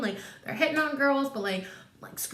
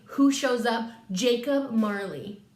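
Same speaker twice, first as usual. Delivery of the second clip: ordinary talk, nothing marked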